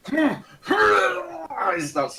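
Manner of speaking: high, loud gasp then heave; guard about to be sick, hammed-up gasp; mock retching gasp. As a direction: play the guard gagging.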